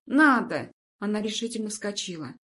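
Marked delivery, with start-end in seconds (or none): 0.1-0.7 loud, emphatic overruling; firm insistence. A narrator's reading. Woman overrules the patient's refusal, loud and insistent. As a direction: cut off the refusal forcefully.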